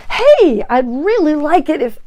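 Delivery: demanding masculine-energy voice; pushy controlling demand. Shown as a demonstration. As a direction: push him like you're taking charge. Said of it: "but not like this".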